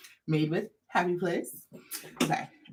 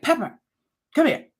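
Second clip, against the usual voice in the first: imitating herself calling the dog, higher and firm; firm command; 6 semitones higher than usual. Owner re-enacting calling her dog, firm. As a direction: give a short, firm mock order to a pet. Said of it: "trying to be firm".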